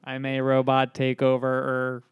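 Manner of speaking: level, monotone robot; every word on one even note. Pretend. Co-host repeating his robot takeover line, robotic monotone.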